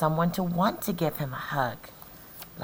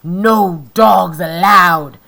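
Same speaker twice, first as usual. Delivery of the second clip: loud, slow shouted rule; angry yell; 16 dB louder, slower than usual. Acted. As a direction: bark it at the coyote. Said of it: "Hank yelled".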